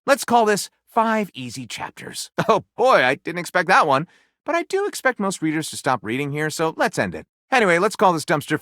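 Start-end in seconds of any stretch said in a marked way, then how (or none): 2.4-3.0 amused, caught off guard; laughing. Real, not acted. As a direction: chuckle it out. Laughs at surprising result.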